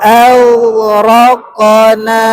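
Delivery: slow, drawn-out melodic tajwid chanting; Quran recitation. Acted. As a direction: chant each phrase slowly for the class to repeat. Recitation for repeating.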